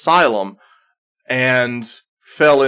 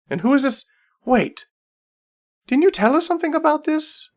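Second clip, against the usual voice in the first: much higher, rising wonder; surprised. Acted. Saradwin spotting the newcomer, high surprised questioning. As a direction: realization dawning.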